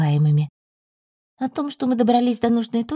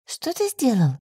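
narrated surprised question; understated surprise; 2 semitones higher, slower than usual. A narrator's reading. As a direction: ask with a touch of astonishment. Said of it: "спросила удивлённо".

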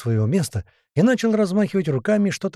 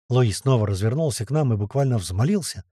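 usual speaking voice

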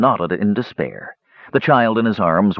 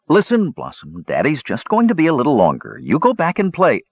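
coaxing daddy; 7 semitones higher than usual. A narrator's reading. Warrior coaxing his child.

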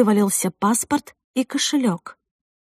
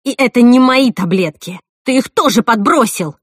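loud, angry accusation; raised, heated outburst; shouted denial; 3 semitones higher, 7 dB louder than usual. A narrator's reading. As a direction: cry out in indignant protest. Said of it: "выкрикивала я".

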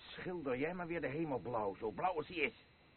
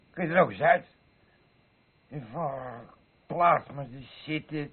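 louder mocking jab; scornful jibe; 9 dB louder than usual. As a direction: jab at her loudly. Snapping at mother-in-law.

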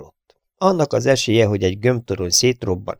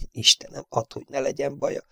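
much softer, pleading prayer; whispered prayer; 6 semitones higher, 8 dB quieter than usual. A narrator's reading. Engineer praying for the gunner, soft plea. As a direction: pray under the breath. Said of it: "fohászkodott".

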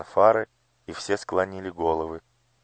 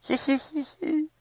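high, slow sycophantic tittering; fawning giggle. Acted. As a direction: giggle like a flattering courtier. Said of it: "захихикал придворный".

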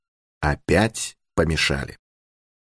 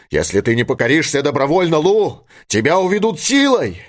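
insistent, raised-pitch coaxing and commands; urging father; 7 semitones higher than usual. A narrator's reading. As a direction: urge the daughter away, higher as patience runs out. Father orders daughter away. (отец настойчиво уговаривает, повысив голос)

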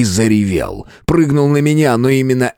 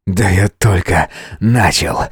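smirking; 2 semitones lower, slower than usual. A narrator's reading. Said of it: "ухмыльнулся ему в ответ".

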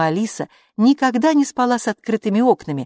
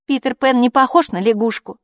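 higher, louder voice than the narration; child character's retort; 6 semitones higher, 5 dB louder than usual. A narrator's reading. Hilla answers her friend about the lamp, child's higher retort. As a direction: read it as a child objecting, pitched up. Child objects in acted story.

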